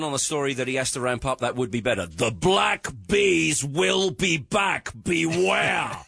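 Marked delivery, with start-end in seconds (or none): 2.1-6.0 very slow, ominous headline read; dramatic newsman voice. Retold or imitated. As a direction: stretch it like a horror teaser, then call out beware. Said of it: "That's what he does".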